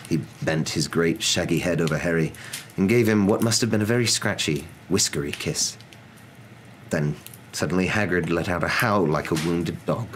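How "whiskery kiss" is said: ordinary delivery, nothing marked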